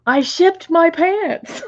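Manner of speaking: slow, high mock ad voice; hammed-up commercial line; quoting the ad. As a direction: stretch it out like the Kmart ad. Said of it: "Do you remember that commercial?".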